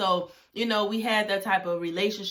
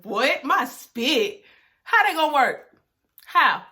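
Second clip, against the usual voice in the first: loud, rising disbelief; can't believe what you just read; incredulous; 4 semitones higher, 8 dB louder, slower than usual. Retold or imitated. Girl reacting to spit cleaning tip, loudly incredulous.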